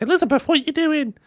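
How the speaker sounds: high alarmed call across the garden; a mother calling her daughter's name; calling mum. Retold or imitated.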